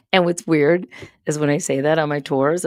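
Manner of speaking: ordinary delivery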